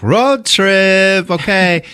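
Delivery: high, loud holler; mock excited announcement. Tommy pulls up in a van for Greg, mock excited. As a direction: imitate an eager director calling out.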